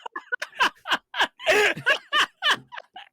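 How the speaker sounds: high helpless laughing fit; loud laughter. Real, not acted. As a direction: crack up completely. DM cracks up.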